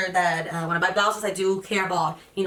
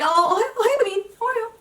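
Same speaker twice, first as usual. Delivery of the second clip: put-on squealing much higher, baby talk to a friend; high cooing greeting; 14 semitones higher, 4 dB louder, much faster than usual. Young girl greets her friend in a high coo.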